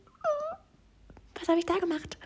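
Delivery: put-on tiny, very high dismayed child voice; scared little girl. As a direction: squeak it like an alarmed little girl. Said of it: "Dieses kleine Mädchen, was da plötzlich sitzt".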